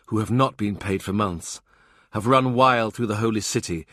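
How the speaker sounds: ordinary delivery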